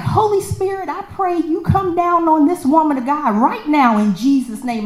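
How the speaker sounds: high, fervent prayer voice; lightly voiced fervent prayer; praying lady. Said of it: "she's like".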